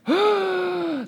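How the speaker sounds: sharp high gasp of shock; sudden startled intake of breath. Acted. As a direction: gasp like the stunned disciples. Disciples shocked at betrayal news.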